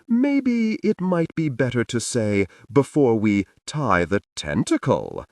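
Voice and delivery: a light, joking tease; chuckling River voice. A narrator's reading. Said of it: "he chuckles".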